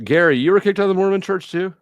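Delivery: ordinary delivery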